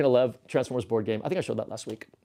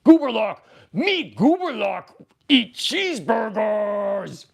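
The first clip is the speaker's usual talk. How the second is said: loud, slow brute monster shout; Gooberlock; 5 semitones higher, 4 dB louder, much slower than usual. Acted. Streamer becomes a Grimlock crossover hungry for burgers, shouted brute voice. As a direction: roar it out, stretching the words. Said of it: "am I Gooberlock then?".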